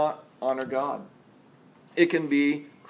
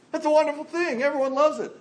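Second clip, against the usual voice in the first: much higher, bright burst of delight; preacher gushing over cannonball jumps; gleeful; 11 semitones higher, faster than usual. Real, not acted. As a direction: squeal with pool fun. Excited about cannonball joy.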